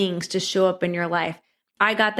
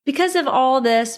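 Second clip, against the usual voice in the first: excited cashier; 6 semitones higher than usual. Retold or imitated. Cashier announcing a discount, voiced with lively excitement. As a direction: pitch it up like she's just had a great idea. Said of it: "She goes".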